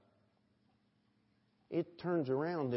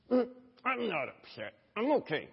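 higher-voiced, lightly mocked denial; feigned calm denial; 6 semitones higher than usual. Preacher acts out people lying about their anger, mock denial. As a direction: play someone pretending not to be upset. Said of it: "angry and you lied about it".

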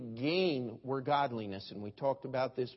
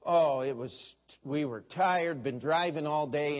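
ordinary talk

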